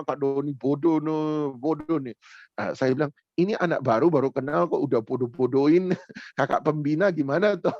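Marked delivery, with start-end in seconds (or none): 0.0-2.1 slow, sing-song mock of a cheeky teen; teasing teen. A cheeky teen teasing his youth leader, slow and mocking. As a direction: drawl out the tease like a cocky kid.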